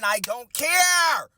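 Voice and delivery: high, loud, drawn-out CARE; slow, defiant holler; defiant shout. Retold or imitated. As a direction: stretch "care" into a loud cry. Defiant declaration of staying.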